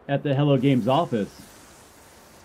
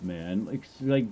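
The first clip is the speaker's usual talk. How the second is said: normal talking voice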